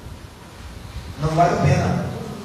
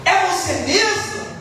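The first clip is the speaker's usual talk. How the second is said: doubting father; 9 semitones higher than usual. Retold or imitated. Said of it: "o pai pergunta".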